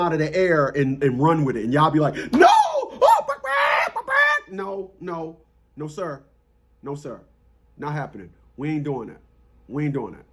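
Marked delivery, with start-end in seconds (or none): 2.3-4.1 1 mocking panic babble. Mocking gullible listeners. 4.5-10.2 1 quiet firm refusal. Real, not acted. Refusing, dropping voice.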